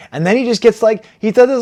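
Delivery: ordinary delivery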